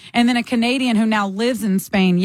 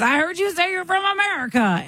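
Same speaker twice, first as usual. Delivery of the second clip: mock shouting; 9 semitones higher than usual. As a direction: imitate a brash tourist barking at you. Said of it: "he's doing that thing where he's shouting".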